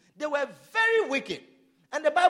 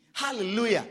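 ordinary talk

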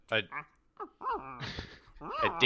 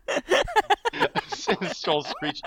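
high, slow laughing words; much slower than usual. Real, not acted. Laughing mid guess.

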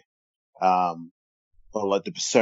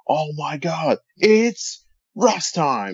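high, drawn-out announcer's exclamation; big, high-pitched mock commentary cry; Michael Cole; 8 semitones higher, much slower than usual. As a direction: stretch it out like an astonished commentator's call. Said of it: "you hear Michael Cole's voice going".

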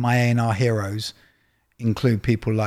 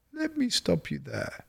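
soft, higher-pitched imitated interruption; Clive Davis; 6 semitones higher, 9 dB quieter than usual. Record executive halting the young A and R man's answer, softly imitated. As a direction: quietly cut in, imitating the boss. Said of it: "And he goes".

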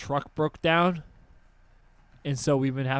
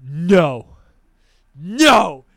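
angry retold no; 16 dB louder than usual. Player refusing after being killed in the game, angry shout. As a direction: belt out an indignant no.

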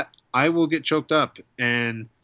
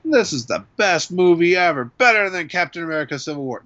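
higher, louder, rushing mock fan gush; mock hyped fan; 6 semitones higher, 4 dB louder than usual. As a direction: gush like an overhyped fanboy. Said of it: "I won't come there thinking".

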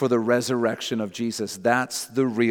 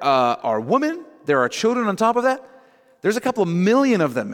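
high, louder, exasperated disbelief; acted-out plea of disbelief, pitched up; incredulous protest; 8 semitones higher, 5 dB louder than usual. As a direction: protest in disbelief at an impossible promise. Moses' incredulous protest to God, acted.

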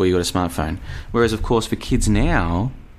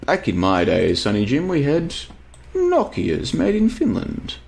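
put-on high, slow old grandpa voice; old boomer voice; 4 semitones higher, slower than usual. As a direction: play a grumpy old man. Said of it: "I've gone into boomer mode".

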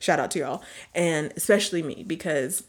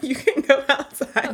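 parent voice; 8 semitones higher, 4 dB louder than usual. Retold or imitated. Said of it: "parents say, like".

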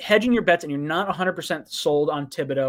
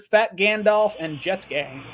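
usual speaking voice

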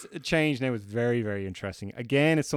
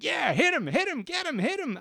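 high-pitched mock cheering at the TV; cheering woman. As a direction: squeal it like a fan egging on a boxer. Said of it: "She's like".

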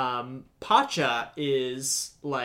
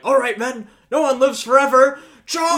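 loud high captain's charge; rallying battle cry; 10 semitones higher, 11 dB louder than usual. Retold or imitated. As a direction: rouse the troops to charge.

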